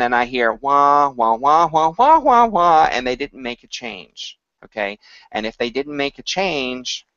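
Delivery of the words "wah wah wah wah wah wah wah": mock mumbling. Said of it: "they sing it again".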